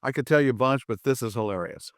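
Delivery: ordinary talk, nothing marked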